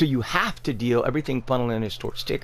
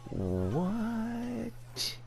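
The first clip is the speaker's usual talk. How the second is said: long, higher drawn-out what, much softer; stunned disbelief; 9 semitones higher, 9 dB quieter than usual. Real, not acted. Player staring at Ticker's prices, stunned disbelief. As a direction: let it hang in total disbelief.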